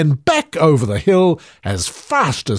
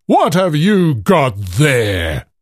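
giant roar; 2 semitones higher, 3 dB louder than usual. A narrator's reading. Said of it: "roared the giant".

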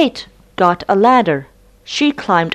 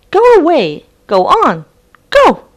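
rising, louder shout to a high go; shooing the dog; 10 semitones higher, 7 dB louder than usual. Acted. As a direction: drive the dog away, getting higher. Kate shoos the dog.

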